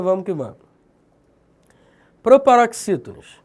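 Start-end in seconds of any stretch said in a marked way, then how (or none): 2.2-3.4 announcing the topic. Shown as a demonstration. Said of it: "announcing a new topic".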